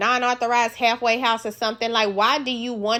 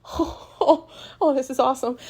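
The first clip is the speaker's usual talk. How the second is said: giddy awe; 4 semitones higher, 4 dB quieter than usual. Retold or imitated. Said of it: "David would be the one in the corner like".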